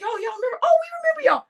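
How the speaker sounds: put-on old neighbours shouting a happy welcome; excited greeting. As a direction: call out delighted to see old friends. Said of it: "They were looking around like".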